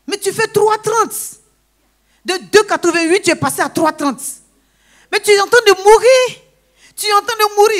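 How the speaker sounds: high, loud panicked doctor; alarmed doctor. Retold or imitated. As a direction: warn her she is dying. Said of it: "Il répétait ça". (le médecin affolé crie qu'elle va mourir)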